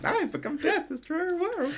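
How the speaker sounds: high, soft talking doll voice; a pull-string doll reciting; pull string toy. Acted. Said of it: "Just pull the string on the back".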